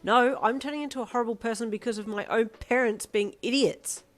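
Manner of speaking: put-on teen yelling at her mum; angry shout. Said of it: "you shouted".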